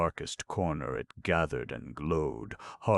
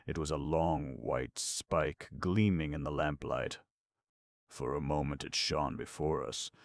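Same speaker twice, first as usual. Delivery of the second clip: usual speaking voice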